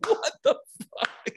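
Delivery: high laugh then breathless what the fuck; laugh, then gasp it out slowly, unvoiced; incredulous laugh and breathy disbelief; stunned laughing disbelief. Retold disbelief at wrestler's answer.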